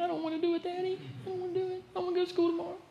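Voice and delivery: put-on high, soft sulky teen whine; whining teenager. Teenager whines to daddy about school, high soft whine. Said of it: "You ever had a teenager say that".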